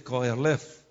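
koelef said slowly and carefully; teaching the word "koelef" to the congregation, slow and clear; Hebrew pronunciation. Shown as a demonstration. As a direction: sound out "koelef" syllable by syllable. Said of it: "decir cómo se pronuncia".

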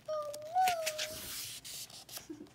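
high falling cartoon laugh; a long high laugh. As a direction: cackle high and let it fall. Laugh after crab storms off.

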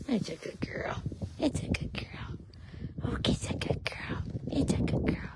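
soft unvoiced praise; soothing whisper. Real, not acted. Owner brushing knots, whispering praise. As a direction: whisper calm praise to the horse.

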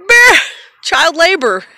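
loud, high playful shout; mock outcry. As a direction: blurt it out at full volume. Mock outcry after the repeat.

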